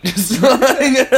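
high laughter swallowing the words; laughing hard. Real, not acted. Cohost cracks up describing the gate, laughing hard. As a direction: break up laughing.

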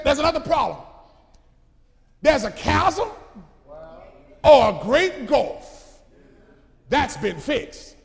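loud preaching, slow on great gulf; preaching at full force. Acted. Abraham to the rich man, loud preaching. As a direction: spell out the gulf to the church.

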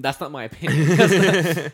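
loud laughing protest, slowed. Real, not acted.

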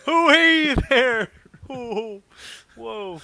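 very high, loud, slow put-on voice; Norm Macdonald impression. Host trying his Norm Macdonald voice, very high and loud. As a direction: go big on a drawn-out greeting. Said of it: "I'm working on a Norm Macdonald".

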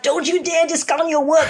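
high-pitched scolding outburst; retold yelling. Mother to daughter who discounts her pedicure work, retold yelling. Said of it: "I start yelling at her".

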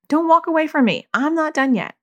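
high-pitched mock parental scolding; insistent parent. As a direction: imitate a parent who won't let it go. Parent chasing down upset child.

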